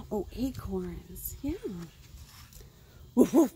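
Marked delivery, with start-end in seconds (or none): 3.1-3.5 louder, barked woofs; dog bark. Acted. Reading to kids, voicing the dog, loud barking woof. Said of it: "said Dog".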